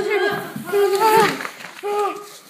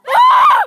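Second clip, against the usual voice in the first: piercing scream, much higher and louder; high panicked scream; 9 dB louder than usual. Real, not acted. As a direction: shriek as it hits. Tornado hits in game.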